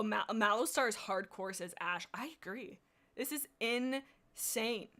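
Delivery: ordinary talk, nothing marked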